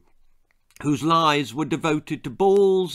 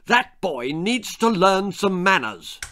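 slow, raised, indignant voice; cross father; 7 semitones higher, 4 dB louder than usual. A narrator's reading. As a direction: spell out your disapproval slowly. Father still cross with Jack.

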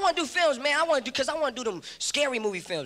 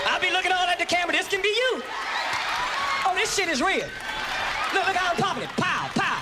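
high excited hype; hyped action star; 5 semitones higher than usual. Pretend. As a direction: show off to the lens. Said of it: "I'd be like".

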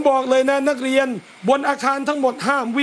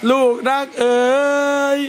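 tender call. Shown as a demonstration. Teacher calling pupil lovingly, tender. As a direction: call the child sweetly. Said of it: "ลูกรักเอ๋ย มาบอกครู".

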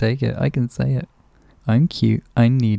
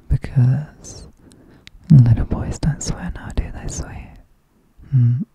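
teasing whisper. Acted.

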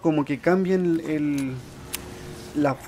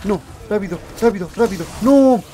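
high, urgent cries, growing louder; panicked shouting; 7 semitones higher, 7 dB louder than usual. Real, not acted. Player being killed urges haste, panicked shouting. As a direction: yell frantically for help.

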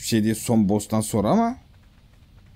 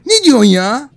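outraged disbelief; 11 semitones higher, 10 dB louder than usual. Real, not acted. Outraged at game creature.

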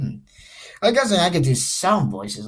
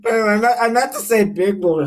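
laughing; 8 semitones higher, 6 dB louder, faster than usual. Real, not acted. Still giggling after the slip.

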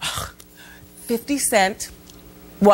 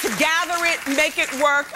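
usual speaking voice

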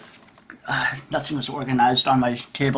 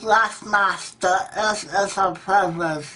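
slow, higher dim-witted imitation; slack-jawed idiot; 3 semitones higher than usual. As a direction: drag it out like a mocked idiot. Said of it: "the slack-jawed idiots go".

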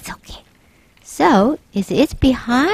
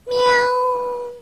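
playful meow; 11 semitones higher, 4 dB quieter than usual. Pretend. Kid pretends to be a cat.

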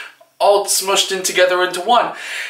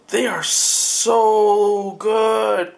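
delighted; much slower than usual. Real, not acted. Admiring baked cookies.

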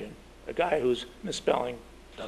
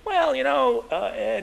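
hedging editor; 12 semitones higher, 5 dB louder, slower than usual. Retold or imitated. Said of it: "And John says".

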